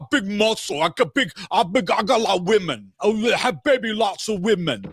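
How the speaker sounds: high, boastful caveman voice; Andrew Tate impression. Mocking Andrew Tate's boasting, in a high caveman voice. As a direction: brag like a muscle-bound brute. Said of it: "He's got the accent down".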